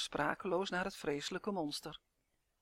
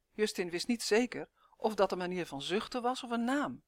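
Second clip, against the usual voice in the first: ordinary delivery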